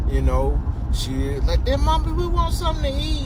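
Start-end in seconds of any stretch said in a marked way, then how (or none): 1.7-3.3 put-on much higher little-kid whine; whiny kid. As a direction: beg your mom like a small child. Acted child asking mother.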